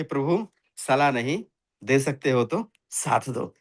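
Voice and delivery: a soft reverent appeal, unhurried; humble calm plea. Acted. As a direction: plead gently and humbly to a god, slowly. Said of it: "आराम से हाथ जोड़े".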